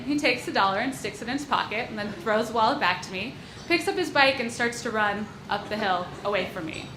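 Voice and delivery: ordinary talk, nothing marked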